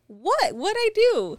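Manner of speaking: very high-pitched imitation of bewilderment; mock innocent protest. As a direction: squeal it as his baffled defence. Imitates boyfriend's defensive reply.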